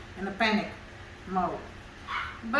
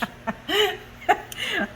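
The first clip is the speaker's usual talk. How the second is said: high laugh; 10 semitones higher than usual. Real, not acted. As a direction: burst of high-pitched laughter. Crepes pronunciation joke.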